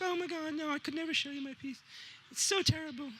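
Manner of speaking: high, mock-girlish wail of embarrassment; shy girl. Shy student refusing to show her art to the teacher, high mock-girlish wail. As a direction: play the shy student who won't show her work. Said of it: "She's like".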